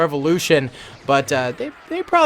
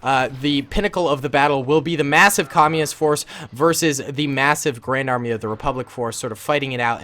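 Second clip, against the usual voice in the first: ordinary talk, nothing marked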